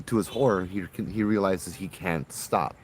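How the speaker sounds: usual speaking voice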